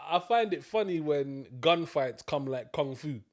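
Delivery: ordinary talk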